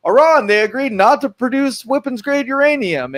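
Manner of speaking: high-pitched naive announcement; hammed-up good-news voice; mock triumphant report. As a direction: proudly announce it, mockingly high. Mock report of a naive diplomatic win.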